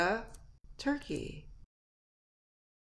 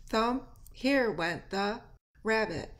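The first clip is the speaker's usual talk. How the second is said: usual speaking voice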